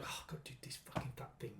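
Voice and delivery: whispered under the breath, mock horror; hushed aside. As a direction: breathe it like a guilty secret. Secret aside about the girl.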